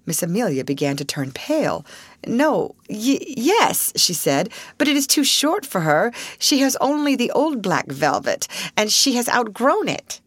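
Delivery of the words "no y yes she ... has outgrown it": higher, stumbling and anxious; flustered. A narrator's reading. Miss Amelia to her sister, flustered. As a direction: trip over the answer.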